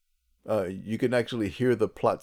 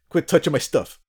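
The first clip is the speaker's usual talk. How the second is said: raised, loud irritated telling-off; playfully annoyed, higher and louder; mock scolding; 6 semitones higher, 5 dB louder than usual. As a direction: scold someone meddling with your things. Acting annoyed owner scolding.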